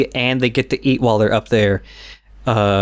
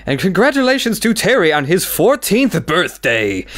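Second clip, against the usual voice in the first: announcer voice; 6 semitones higher than usual. Retold or imitated. Narrator reading birthdays aloud.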